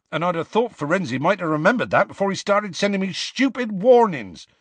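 heated Hagrid grumble; hot-tempered. Acted. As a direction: resentment boiling over. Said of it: "he added hotly".

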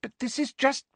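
high, pleading protest; falter, then plead in a high voice; desperate high-pitched objection. A narrator's reading. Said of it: "said Hermione desperately".

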